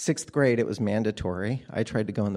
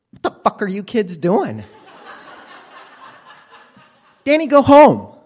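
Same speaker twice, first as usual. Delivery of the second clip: furious high shout, much louder; mom yelling; 11 semitones higher, 15 dB louder than usual. Retold or imitated. Mom bursting in on the kids, furious shout. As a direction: yell it like a mom catching the kids in the shed.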